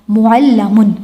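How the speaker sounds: "معلمن" said mu'allamun, with an a before m; passive participle vowels. Shown as a demonstration. Teacher demonstrates passive participle, "معلمن" as mu'allamun. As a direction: give "معلمن" the mu-a sound: mu'allamun. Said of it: "موآ sound آئے گی،".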